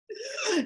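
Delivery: sharp high gasp of shock. Retold or imitated. Granddaughter finding grandma on the floor, shocked gasp. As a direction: gasp as if you just found her. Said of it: "I'm shocked".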